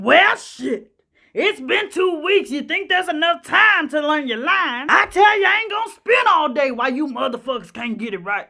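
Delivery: loud, scornful tirade with a shout on I tell you; imitating a diva's rant. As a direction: chew out the cast like a furious star. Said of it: "Ethel would light into 'em".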